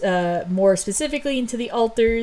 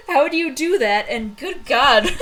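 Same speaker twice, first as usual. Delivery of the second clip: laughing; 5 semitones higher, 3 dB louder, slower than usual. Real, not acted. Laughing at her own retelling.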